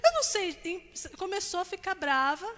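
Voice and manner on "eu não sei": high, fast whining complaint; frustrated child. Retold or imitated. Daughter complaining she can't play, high and whiny. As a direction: whine like a kid who can't do it.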